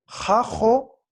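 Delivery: "Jajo" with a rasped back j, ending open on o, lifted and loud; dialect variant pronunciation. Shown as a demonstration. Teacher modelling a dialect variant for students, "Jajo" pronounced clearly. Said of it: "Es una variación dialectal nada más".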